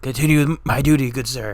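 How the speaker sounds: hammed-up dying general, fake coughs; dying old man voice. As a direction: play a man gasping his last orders. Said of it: "Anthony Wayne's like".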